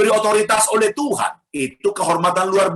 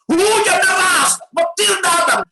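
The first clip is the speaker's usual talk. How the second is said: dramatic storm; 12 semitones higher, slower than usual. Acted.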